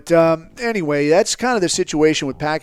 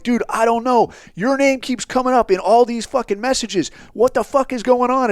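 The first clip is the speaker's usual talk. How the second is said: high-pitched, exasperated comeback; retold agitated retort; 8 semitones higher than usual. Narrator confronting the frightened passenger in the car, agitated retort. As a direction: act out pressing a panicked man for answers. Retold heated answer to panicking man.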